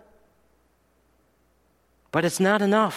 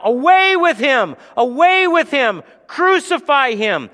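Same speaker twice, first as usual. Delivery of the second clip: high, loud mob yell; crowd shouting; 10 semitones higher, 6 dB louder than usual. Acted. The crowd demanding crucifixion, loud shouted chant. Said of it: "They cried out".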